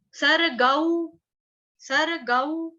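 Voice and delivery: "सर गौ" slow, separated; split syllables. Shown as a demonstration. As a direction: break "सर गौ" into two parts. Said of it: "जैसे गौ माता होती है ना". ("सर गौ" धीरे, अलग अलग)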